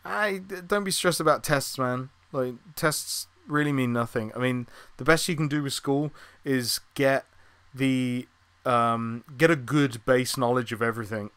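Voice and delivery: usual speaking voice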